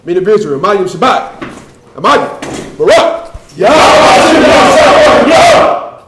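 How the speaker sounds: loud drill-style commands building to a high, drawn-out shout; shouted salute. Real, not acted. Courtesy salute to the men and teachers, shouted. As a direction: bellow it, climbing to the last line.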